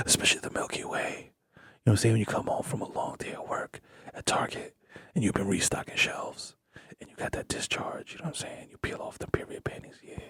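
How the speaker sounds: breathy seductive whisper. Acted. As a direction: whisper it low and intimate.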